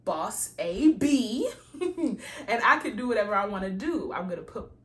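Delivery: loud, laughing boss talk; confident hype. Retold or imitated. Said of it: "that knowing of".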